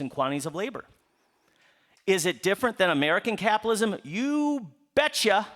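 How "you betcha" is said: drawn-out, high Wisconsin-Minnesota "you betcha"; Midwestern expression. Shown as a demonstration. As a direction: lean high into a Midwestern lilt. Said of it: "I'm originally from Wisconsin and Minnesota".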